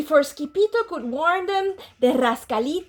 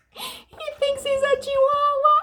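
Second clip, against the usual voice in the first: laughing out the words, much higher; 12 semitones higher than usual. Real, not acted. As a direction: giggle through the line. Reader amused by the kitten.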